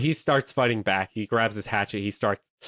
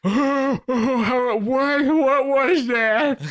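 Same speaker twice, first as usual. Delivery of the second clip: high, loud, slow startled cry; shrieking bewildered goofball; high startled yelp; 6 dB louder, much slower than usual. Acted. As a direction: gasp it out like a cartoon fool.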